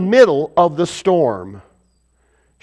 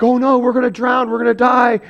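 higher-pitched imitation of panicking men; mildly hammed-up alarm, pitched up; mock panic; 5 semitones higher than usual. Disciples alone in the storm fearing drowning, mock panic. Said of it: "like, panicking".

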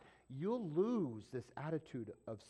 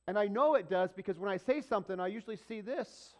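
preached loudly; 6 semitones higher, 9 dB louder than usual. Real, not acted. Loud preached line.

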